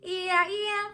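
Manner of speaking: sudden high loud yeah yeah; odd vocal outburst. Real, not acted. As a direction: blurt it out in a high burst. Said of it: "I just do that shit sometimes".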